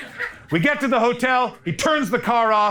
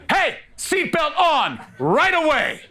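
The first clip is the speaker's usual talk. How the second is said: higher, slow mock driver command; gruff driver order; 6 semitones higher, slower than usual. Uber driver ordering comedian to buckle up, mock stern. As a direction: bark it like a bossy Uber driver.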